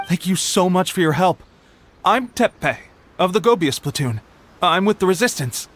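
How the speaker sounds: ordinary talk, nothing marked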